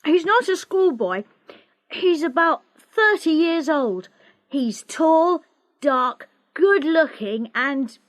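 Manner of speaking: put-on a cheeky boy taunting his sister; teasing boy. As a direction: rattle off the teasing gleefully.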